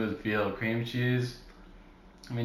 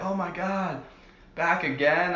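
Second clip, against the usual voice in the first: higher, surprised cashier voice; imitating the cashier; 6 semitones higher than usual. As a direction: play her shocked delight. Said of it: "she's like".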